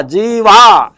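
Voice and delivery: ordinary delivery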